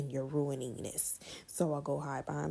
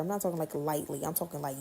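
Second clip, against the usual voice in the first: usual speaking voice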